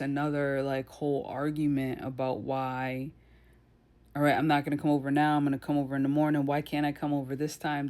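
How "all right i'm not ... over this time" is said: rattled off fast, mimicking her complaints; mock whiny rant. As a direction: gabble it fast like a nagging girlfriend. Mocking girlfriend's whining argument.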